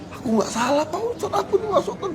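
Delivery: crying plea. Retold or imitated. Prisoner crying in the ustadz's arms, softly sobbing. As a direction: whimper out the innocence.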